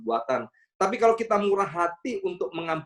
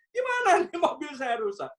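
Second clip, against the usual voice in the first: high, pitched-up complaining driver; mock angry driver; 12 semitones higher than usual. The hit driver scolds the pastor again, mock angry complaint. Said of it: "saya dimarahin lagi".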